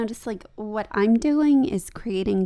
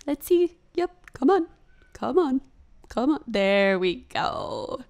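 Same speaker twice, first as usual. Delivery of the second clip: straining; 5 semitones higher than usual. Real, not acted. Straining into a deep stretch.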